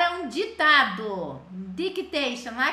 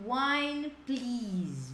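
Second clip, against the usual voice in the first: slow model phrase; much slower than usual. Shown as a demonstration. Teacher modeling the phrase for students, slow and clear. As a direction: spell it out slowly for the class.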